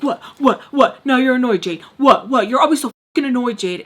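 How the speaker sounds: loud, rapid-fire irritation; mock snappy mother. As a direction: imitate an irritated mom firing back. Mom snapping in retold quarrel.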